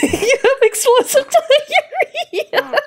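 high-pitched words through giggles; laughing. Real, not acted. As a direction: crack up while speaking, pitch shooting up. Laughing at gross toilet scene.

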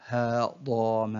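very slow, each syllable drawn out separately; broken into syllables. Shown as a demonstration. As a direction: sound out the word syllable by syllable. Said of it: "تقطع الكلمة إلى حروف". (تقطيع الكلمة ببطء شديد مقطعًا مقطعًا)

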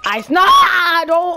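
high, loud, slow cry of alarm; a loud high startled plea; panicked shout. Real, not acted. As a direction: cry out as you get hit. Panic under attack.

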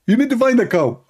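high-pitched, emphatic put-on voice; insistent, high mock-divine instructions; Allah. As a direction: hand down orders in a comic high voice. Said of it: "Allah, he answered".

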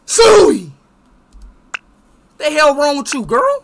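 high, loud indignant yell; angry yelling. Real, not acted. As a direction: shout at her in outrage. Gamer yelling at girlfriend.

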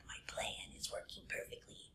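soft, scheming whisper; sneaky snake whisper. Acted. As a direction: hiss out the plan as a sly villain. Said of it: "that's exactly how he sounded".

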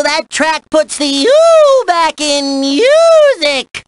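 high whooped vowels, drawn out; ecstatic rave. Acted. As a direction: whoop the ooh sounds long and high inside the line. Raving about the track.

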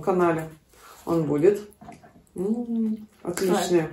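talking while chewing food; muffled by mouthful of fritter; mouth full, chewing. Real, not acted. Seen 1: Woman talks with puffed cheeks, chewing fritter she holds throughout.

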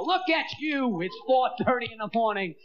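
ordinary delivery

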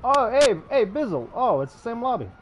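high, loud surprised hello; excited greeting. Real, not acted. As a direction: burst out happy to see a friend. Surprised to see Bizzle again.

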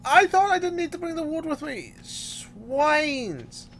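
exasperated outcry. Real, not acted. Player realising the wood was left behind, exasperated outcry. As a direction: curse in high-pitched frustration. Forgot wood, cursing the villagers.

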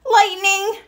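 high, loud scared cry; frightened shriek. Acted. Franklin terrified by the lightning flash, shrieking. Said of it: "shrieked Franklin".

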